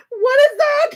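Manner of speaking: very high, loud squeal; high-pitched incredulous yelp; shrieking disbelief. Real, not acted. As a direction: shriek it in giddy shock. Shocked repeat after laughing.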